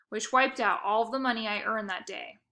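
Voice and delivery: usual speaking voice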